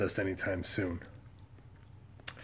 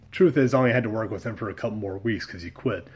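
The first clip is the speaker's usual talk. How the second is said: normal talking voice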